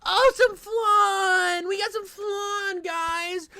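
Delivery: high, slow, squealing cheer; hammed-up delight over flan; mock excited shout. Mocking the flan feeding clip.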